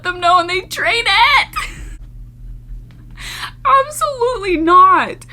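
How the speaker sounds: high, loud laughing absolutely not; laughing refusal. Real, not acted. Reacting to the drainage story, high laughing refusal. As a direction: refuse while cracking up.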